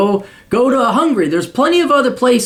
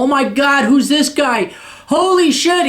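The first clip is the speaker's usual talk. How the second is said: high, gushing mock millennial squeal; wide-eyed mock fan voice, pitched well up; 7 semitones higher than usual. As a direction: ham up a clueless kid who just found the channel. Mocking new millennial viewers.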